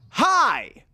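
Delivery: high, drawn-out "hi" falling away; mock greeting. Man greeting boys who burst through his door, hammed-up greeting. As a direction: belt out an over-eager hi. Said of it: "demanded the man angrily".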